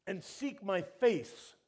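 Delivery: loud, slow, high proclamation; preacher booming the word; booming scripture. Acted. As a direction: thunder the verse out slowly.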